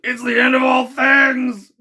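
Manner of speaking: high, loud, slow cry of doom; despairing shout. Acted. Ned shouts as the panels slide open, terrified. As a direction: bellow it as the world ends. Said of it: "said Ned Land".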